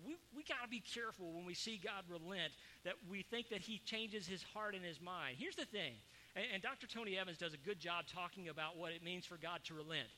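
normal talking voice

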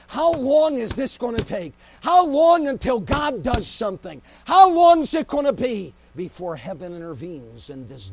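loud desperate shout to the sky; screaming at heaven. Acted. Habakkuk screaming at heaven over Jerusalem, desperate shout. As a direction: cry out at heaven, full voice. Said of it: "He starts screaming at heaven".

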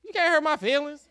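put-on loud, blunt "girl" to his wife; a hammed-up tough-guy brush-off; tough loud retort. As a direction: bark it back at her, half laughing. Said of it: "I told Janita".

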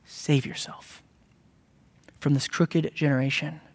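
quiet, solemn urging from the pulpit; hushed appeal. Real, not acted. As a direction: drop the voice and plead quietly.

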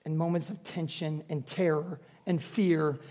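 soft, low, slow preaching; hushed reassuring close; tender. Acted. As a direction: draw them gently into peace.

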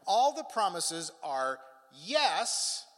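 "yes" set apart, high and loud; slow build to a punched "yes"; emphatic. Shown as a demonstration. Preacher showing how emphatic scripture is, "yes" punched high after a pause. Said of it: "the word is so emphatic".

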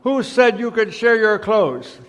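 high, loud, drawn-out outrage; teacher yelling. A narrator's reading. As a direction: yell it slowly as a scandalised teacher. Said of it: "The teacher yelled".

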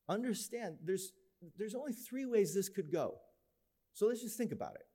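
a gentle parent pep talk; patient explaining. Retold or imitated. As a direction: coax the kids gently through it. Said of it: "parent lecturing kids patiently".

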